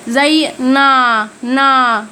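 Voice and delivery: نا said very slowly and drawn out, syllable by syllable; slow Korean syllable. Shown as a demonstration. Teacher drawing out نا slowly for students learning Korean. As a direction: stretch نا out long and slow for the class. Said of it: "نا اللي هي أنا".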